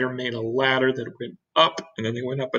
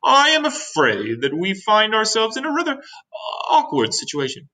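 formal grasshopper, pitched up then settling; suppressed fear; 9 semitones higher than usual. A narrator's reading. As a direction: break bad news, trying to keep composed. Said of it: "trying very hard to keep a f-, fear and disappointment out".

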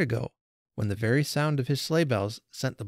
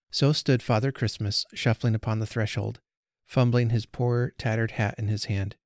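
plain reading voice

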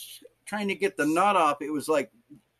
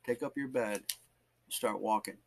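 soft, lowered command; hushed exhortation; 4 semitones lower, 8 dB quieter than usual. Retold or imitated. Preacher repeating the scripture God showed him, hushed and solemn. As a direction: say it quietly and solemnly.

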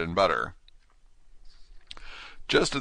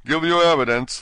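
higher, louder impatient order; king losing patience with the Hatter; angrily repeated; 9 semitones higher, 8 dB louder than usual. Acted. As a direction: repeat it with rising temper. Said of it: "the king repeated angrily".

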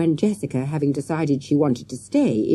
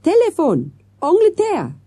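put-on loud, high call with French accent; shouted; 14 semitones higher, 6 dB louder than usual. A narrator's reading. Manager calling the waiter to an English phone call, shouting. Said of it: "he shouted".

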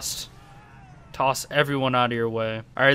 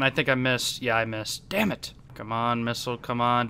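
usual speaking voice

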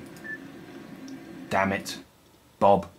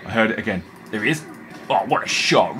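ordinary talk

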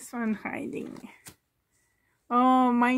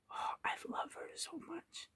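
whisper; 6 semitones higher, 19 dB quieter than usual. Real, not acted. Adoring a favourite doll.

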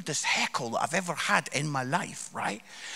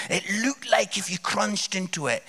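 ordinary talk

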